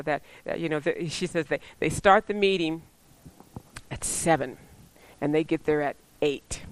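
ordinary delivery

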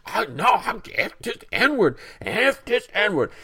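high, loud mock defiance; defiant shout. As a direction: double down like a raging stranger. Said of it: "she doubles down".

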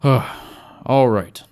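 sigh, then speak; weary sigh. A narrator's reading. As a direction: let out a sigh into the words. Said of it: "Gel sighed".

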